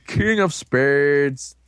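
stretched-out card announcement; slow reveal. Retold or imitated. Storyteller revealing the last card, slow and drawn out. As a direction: draw out each word for suspense. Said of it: "does a little slow reveal".

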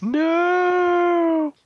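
high anguished cry of no. Retold or imitated. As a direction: scream it as he falls. Hero sees friend take the hit.

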